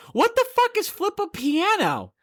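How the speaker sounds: high-pitched mock bewilderment; baffled outburst. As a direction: squawk it like an utterly confused brother. Voices brother's baffled outburst.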